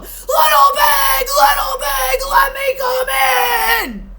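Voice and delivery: loud, high gruff shout; gruff wolf voice. Acted. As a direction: bellow it like a wolf. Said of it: "in a frightfully gruff voice".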